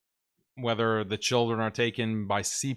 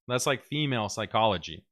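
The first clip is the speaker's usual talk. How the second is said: ordinary delivery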